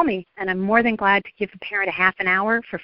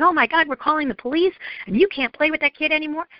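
higher, quick mock alarm; mildly acted parental panic; overreacting parent; 7 semitones higher, faster than usual. Parent overreacting to Joey's phone, mock alarm. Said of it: "I'm not going".

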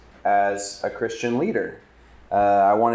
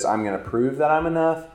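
higher, pushy mock boast; boastful guy; 6 semitones higher than usual. A preacher voicing the man who must prove himself, mock bold. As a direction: puff it up like a guy proving himself. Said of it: "both the guy who says".

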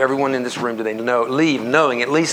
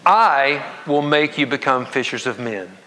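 "I" drawn out and set apart, the rest slower; stress on I. Shown as a demonstration. Preacher leading congregation in an emphasis exercise, stress on I. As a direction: lean hard on the word "I", then ease off. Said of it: "Read each of these emphasized words".

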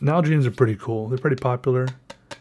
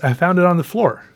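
bright, higher and louder; excited; 6 semitones higher, 5 dB louder than usual. Real, not acted. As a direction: share the find with delight. Repeats the line with excitement.

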